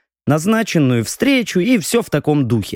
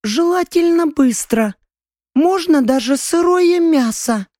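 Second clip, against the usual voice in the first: high voiced character; 12 semitones higher than usual. Hungry young man asking food.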